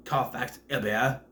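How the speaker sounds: slow, stilted imitation English accent; drawn-out mock British accent; Keanu's Dracula accent. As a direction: mimic a bad movie English accent. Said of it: "his accent from the devil, uh, the Bram Stoker's Dracula".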